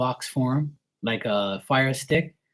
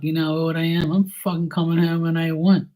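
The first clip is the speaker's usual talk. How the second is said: higher, defiant talk-back to dad; teen son mouthing off to his father; defiant retort; 6 semitones higher than usual. Retold or imitated. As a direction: throw it back at him, half shouting. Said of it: "I'm like".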